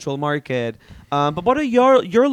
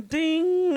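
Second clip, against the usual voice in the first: imitated doorbell ding; 12 semitones higher than usual.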